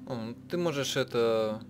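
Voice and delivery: usual speaking voice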